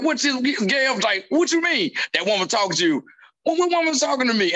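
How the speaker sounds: high, puzzled questions; hammed-up confusion; mock confused. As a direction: play it baffled, pitch up. Confused back-and-forth retold.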